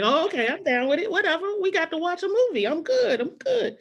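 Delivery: put-on high, eager kid voice. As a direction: pitch it up like an excited kid. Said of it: "I was like".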